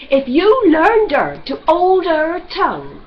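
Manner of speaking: loud, petulant complaint. Acted. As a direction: whine it out crossly. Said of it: "he said pettishly to his wife".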